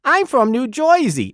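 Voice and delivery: mock the New Jersey way of talking; New Jersey accent. A brother mimicking a New Jersey accent, playful. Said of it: "he doesn't talk like that at all".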